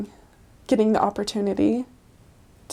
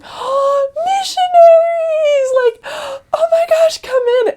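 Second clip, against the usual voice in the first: much higher, louder squeal of delight; excited old lady; 8 dB louder, slower than usual. Retold or imitated. Old woman greeting missionaries at her door, delighted squeal.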